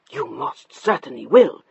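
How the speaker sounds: ordinary delivery, nothing marked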